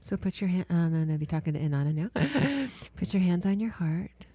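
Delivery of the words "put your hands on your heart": whispered, slow meditation guidance; guided meditation. Acted. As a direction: ease her into breathing, hushed.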